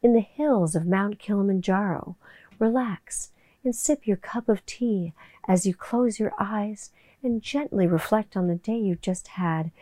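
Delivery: soft, slow, hushed meditation read; relaxing voice. Shown as a demonstration. Demoing her relaxing YouTube vocal drop voice, soft and slow. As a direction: lull them gently into calm. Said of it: "I have to do my relaxing voice".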